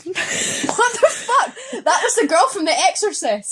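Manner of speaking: high, laughing outburst; laughing disbelief. Real, not acted. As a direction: blurt it out through laughter, high-pitched. Reacting to a botched impression.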